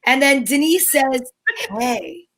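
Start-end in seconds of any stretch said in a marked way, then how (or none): none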